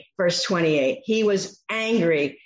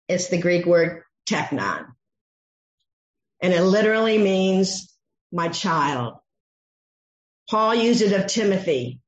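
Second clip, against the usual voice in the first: normal talking voice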